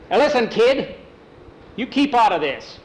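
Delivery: storyteller acting an irritated foreman; stern scolding. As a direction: warn the boy off forcefully. Foreman scolds the interrupting boy.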